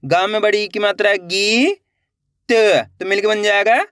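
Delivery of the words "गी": गी with a long, held ई; drawn-out vowel. Shown as a demonstration. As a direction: stretch the ई in गी, lifting at the end. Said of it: "तो खींच के पढ़ेंगे".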